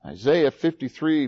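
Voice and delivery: ordinary talk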